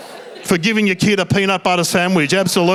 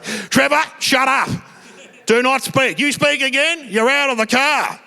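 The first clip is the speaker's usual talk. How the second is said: higher, sharp fatherly scolding; angry dad; 7 semitones higher than usual. Retold or imitated. Dad scolding Trevor in the car, stern raised voice. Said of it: "Dad's like".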